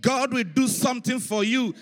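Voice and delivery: high, loud, slow declaration; roaring prophetic proclamation; preaching at full force. Real, not acted. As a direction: shout the blessing over the crowd, stretching each phrase.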